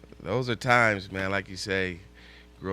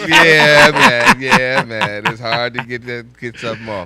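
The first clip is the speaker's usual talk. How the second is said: laughing hard; 3 semitones higher, 17 dB louder than usual. Real, not acted. Host laughing at a cohost's joke, words breaking into laughter. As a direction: still cracking up mid-sentence. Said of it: "we gotta have some laughter".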